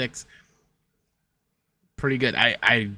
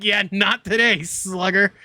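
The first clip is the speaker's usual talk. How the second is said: mock brush-off; 8 semitones higher, 3 dB louder, slower than usual. Mocking the card makers' response.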